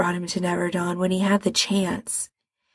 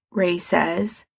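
usual speaking voice

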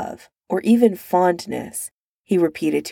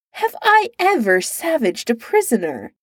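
demanding; 6 semitones higher than usual. A narrator's reading. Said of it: "he demanded to know".

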